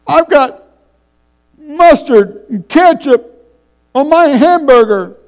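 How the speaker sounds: high, loud, slow mock-sobbing boy; imitating a crying child. Preacher mimics his young son crying at dinner. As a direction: ham up a little boy wailing over his burger. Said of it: "and he started crying".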